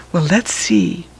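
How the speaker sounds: ordinary delivery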